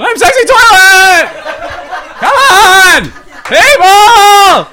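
high, loud, drawn-out protest; hammed-up indignant holler; mock desperate yell. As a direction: wail it at a jeering crowd. Pleading with party crowd, yelling.

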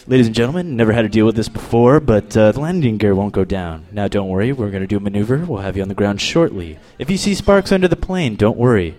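low, unruffled intercom announcement; cool pilot imitation. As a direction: reassure passengers as an unflappable pilot. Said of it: "He sounded so cool when he said that".

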